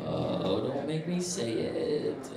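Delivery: very soft, slow reluctant plea; reluctant groan. Retold or imitated. Narrator dreading the question, soft slow groan. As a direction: dread having to say it.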